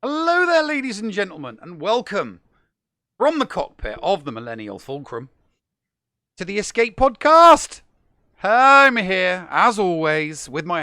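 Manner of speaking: big, loud host opening; show host welcome. Acted. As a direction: welcome them like a showman.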